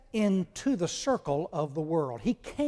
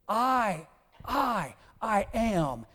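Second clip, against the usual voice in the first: higher, insistent "I", said over and over; raised pitch on every "I"; emphatic repeated "I"; 5 semitones higher, much slower than usual. Acted. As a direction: press on each "I" separately. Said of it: "That's what Paul says here".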